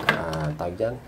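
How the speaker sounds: usual speaking voice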